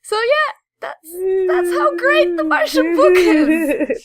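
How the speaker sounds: breaking up with real laughter mid-sentence; can barely get it out for laughing. Real, not acted.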